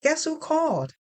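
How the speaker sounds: brightly. Acted. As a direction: tease him with good news. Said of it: "I said brightly".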